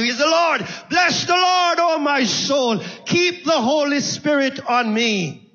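driving preached prayer that climbs to a shouted praise; fervent preaching. Acted. Preacher as David crediting God for every victory, fervent preaching.

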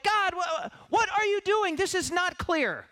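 high, drawn-out plea to God; congregant's frustrated cry to God; exasperated prayer. Acted. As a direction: complain to God, baffled. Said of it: "you're like".

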